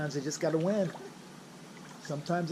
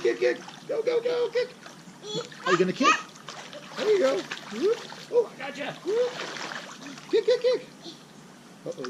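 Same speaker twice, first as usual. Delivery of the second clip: put-on much higher sing-song cheering for a baby; baby-talk cheering; 12 semitones higher than usual. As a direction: cheer the little swimmer on, bright and bouncy. Dad cheering baby in pool.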